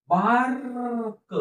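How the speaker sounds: drawn-out sound-by-sound reading of bark; slow blending. Shown as a demonstration. Teacher showing pupils how to blend, drawn out. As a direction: stretch bark slowly, sound by sound. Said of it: "हळूहळू सावकाश म्हणून दाखवत आहे".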